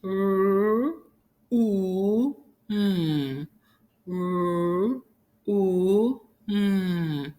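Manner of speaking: ROOM as three held sounds, r, oo, m; making the sounds. Shown as a demonstration. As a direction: hum out R, U, M separately. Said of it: "Let's make the sound".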